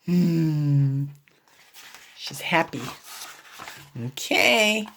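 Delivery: usual speaking voice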